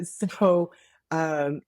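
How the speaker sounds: ordinary delivery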